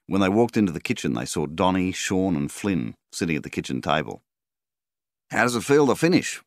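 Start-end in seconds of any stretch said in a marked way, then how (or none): 5.3-6.4 higher and louder, jovial and hearty; a cheery ribbing question. A narrator's reading. Donnie ribbing Melvin about finishing harvest, jovial. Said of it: "asked Donnie jovially".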